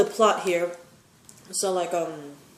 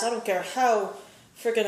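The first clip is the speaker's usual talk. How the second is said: ordinary talk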